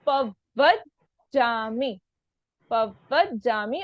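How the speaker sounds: slow पवज्जामि as pav-vaj-jaa-mi; पवज्जामि broken into stretched syllables; half plus full letters. Shown as a demonstration. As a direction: hold the v and j in पवज्जामि. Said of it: "आधा व पूरा व".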